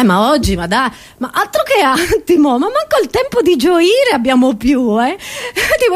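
ordinary talk, nothing marked

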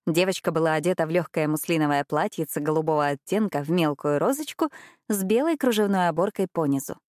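plain reading voice

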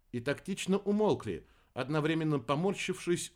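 ordinary delivery, nothing marked

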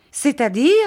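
bristling mother cuts in; indignant exclamation. A narrator's reading. Offended mother interrupting her daughter, indignant cry. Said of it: "s'écria mistress Markleham".

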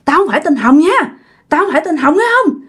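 high-pitched, indignant scolding; acted spirit's angry rant; possessed woman's accusation. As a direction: insist on who you are, then curse your friend. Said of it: "con quỷ".

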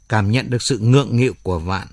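ordinary delivery

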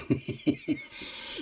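short dismissive chuckle; scornful laugh. Acted. Moneylender brushing off the lady's touch, dismissive laugh. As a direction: laugh her off. Lheureux rejects her advance.

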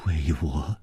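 low, soft questioning echo; hushed disbelief. Acted. Woman puzzled at revenge on her behalf, hushed. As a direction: echo it faintly.

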